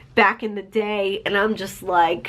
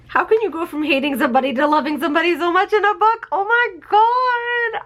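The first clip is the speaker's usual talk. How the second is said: emotional wail; 8 semitones higher, faster than usual. Real, not acted. Reader overcome by the book's love story, emotional wail. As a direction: wail it out, voice pitched right up.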